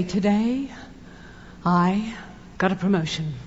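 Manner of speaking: quiet, low, halting delivery; understated announcement. Acted. You walk in the door with promotion news, understated. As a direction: say it softly with pauses, holding it in. Acted modest announcement at home.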